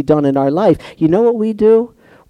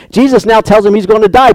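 ordinary talk, nothing marked

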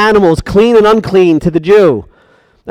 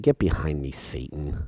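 much lower, soft but stern rebuke; 18 dB quieter than usual. Acted. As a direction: drop low and rebuke firmly. Jesus rebuking, very low.

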